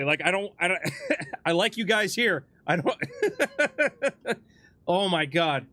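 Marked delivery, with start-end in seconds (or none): 3.0-4.3 high relieved giggle; nervous laugh. Real, not acted. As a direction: giggle off the scare. After being scared.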